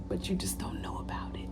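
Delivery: soft unvoiced whisper aside; hushed confiding whisper. Real, not acted.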